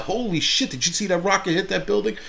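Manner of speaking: like watching fireworks with popcorn; excited gawking. Pretend. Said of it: "I'm like".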